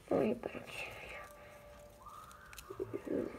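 whispered threat; menacing whisper. Acted. Unicron's foe threatening his enemy in a whisper. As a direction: breathe the threat, no voice.

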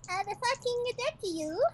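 high, slow cute anime threat; sweet girly voice threatening; cute anime streamer. Acted. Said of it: "sounds like a Japanese anime girl".

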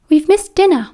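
put-on high, plaintive boy's voice; lightly voiced Peter. A narrator's reading. Boy suddenly breaking the silence with friends, lightly voiced and high.

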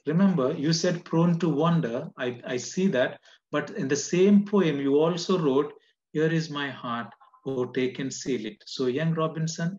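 woman urging Robinson back to God, loudly; fervent plea. Acted. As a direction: press the point with fire. Woman's plea, preached loud.

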